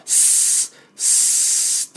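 "スー" as a long voiceless hiss; unvoiced s held long on "スー"; air-leak s. Shown as a demonstration. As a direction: hiss "スー" like air escaping a small hole. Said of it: "小さな穴から空気の漏れる".

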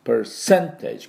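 percentage with a light per and the stress on sen; slow percentage, the per barely there and the sen stressed; slow stressed demo word. Shown as a demonstration. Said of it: "ここにアクセントがあります".

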